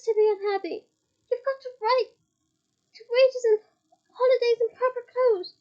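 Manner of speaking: loud, high, impassioned speech; rallying the elves to their rights; high, loud, passionate appeal; impassioned plea. A narrator's reading. Hermione lectures the elves.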